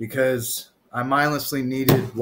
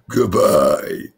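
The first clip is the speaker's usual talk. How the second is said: much lower and louder farewell; deep mock goodbye; 6 semitones lower, 5 dB louder than usual. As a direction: boom out a dramatic goodbye. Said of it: "Feel like goodbye?".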